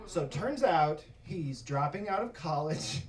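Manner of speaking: ordinary talk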